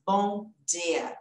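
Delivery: "bom dia" with the d pure, "dee-a"; hard dental D. Shown as a demonstration. Teacher likening the English sound to Portuguese, hard D. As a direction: separate "bom" and "dia", clean d. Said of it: "É como se eu falasse".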